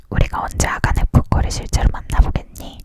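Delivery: usual speaking voice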